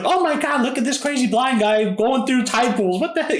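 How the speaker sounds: high, mock-scandalized gasp of strangers; shocked onlookers. Beachgoers gawking at a blind dad on the rocks, high mock shock. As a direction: squeal it like gawking tourists. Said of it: "People thought, thought we were nuts".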